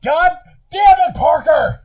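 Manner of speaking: high, loud exasperated shout; hammed-up furious yell at a rival; mock outrage. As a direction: shout it like you've been robbed. Acted fury at Parker stealing the F-bomb.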